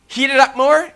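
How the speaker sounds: high, loud incredulous question; mock suggestion. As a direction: toss out the absurd idea loudly. Mock guess at king's response.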